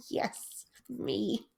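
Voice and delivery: soft, amused whispered admission; whispered aside. Real, not acted. Speaker owning up to the symptom she read, whispered aside. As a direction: whisper it with a breath of laughter.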